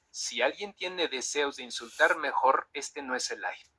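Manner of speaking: ordinary delivery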